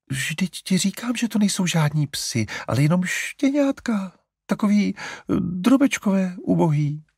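a mother wounded on the puppies' behalf; offended. A narrator's reading. Mother defending the puppies to Larry, offended. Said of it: "namítla maminka dotčeně".

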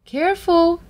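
put-on high-pitched girlish caution; Bo Peep warning. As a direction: call a careful warning in a little girl's voice. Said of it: "Peep said".

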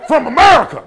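very loud, high shout; hammed-up, high-pitched holler; mock defiant yell. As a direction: bellow it at the laughing crowd. Mock outburst at laughing crowd.

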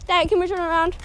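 ordinary talk, nothing marked

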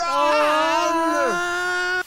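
loud high fright scream. Pretend. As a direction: shriek in terror.

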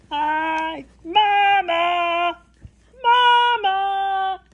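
high, drawn-out singsong call. Acted. Baby llama calls downstairs to his mama, calling out. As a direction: sing out for mama from afar. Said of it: "red pajama calls down to his llama mama".

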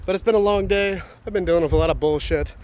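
winded and puffing; out of breath. Real, not acted. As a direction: gasp between the words. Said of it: "I'm out of breath".

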